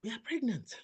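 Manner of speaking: high, excited burst of news; excited announcement. Retold or imitated. Telling her husband on the phone: excited, high-pitched. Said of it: "I didn't know I was screaming".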